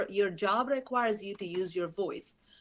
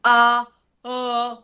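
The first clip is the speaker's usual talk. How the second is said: loud, higher pushed "uh, uh"; projected grunts; 4 semitones higher, 10 dB louder than usual. Shown as a demonstration. Voice coach demonstrating projection to the audience, loud pushed uh. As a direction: push the sound out hard. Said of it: "project your voice from your mouth and diaphragm instead of your throat".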